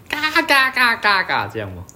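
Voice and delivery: high loud honking squawk; drunken squawking. Retold or imitated. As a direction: screech like a rowdy drunk. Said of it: "你说鬼吼鬼叫是". (高声怪叫)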